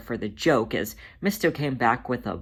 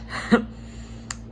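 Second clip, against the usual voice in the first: tired breathy laugh; a spent, weary chuckle; exhausted laugh; 4 dB quieter than usual. Acted. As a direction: let out a worn-out little laugh. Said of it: "Munkustrap let out an exhausted laugh".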